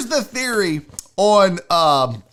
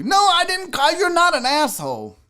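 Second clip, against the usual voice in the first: much higher, pleading denial; high-pitched protest; 11 semitones higher than usual. Real, not acted. Host assures an offended chatter he meant no insult, protesting.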